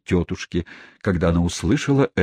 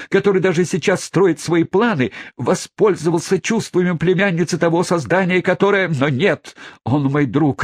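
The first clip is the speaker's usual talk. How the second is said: melodramatic; 10 semitones higher than usual. Acted. Hammed-up melodramatic speech.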